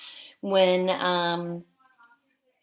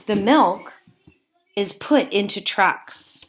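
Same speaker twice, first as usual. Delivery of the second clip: put-on slow, even read-aloud for young listeners; reading to children; slower than usual. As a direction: read each sentence to the class with care. Teacher reads book to class.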